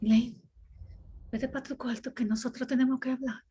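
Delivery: stern mother calling her daughter, lightly voiced; mother ordering her daughter to her room, in Spanish; her mother's voice. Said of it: "when I become my mother".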